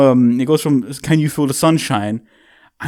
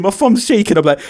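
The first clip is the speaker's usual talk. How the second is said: normal talking voice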